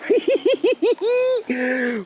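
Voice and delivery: high joyful burst of laughter; delighted laugh. Real, not acted. Laughing at landing the bass.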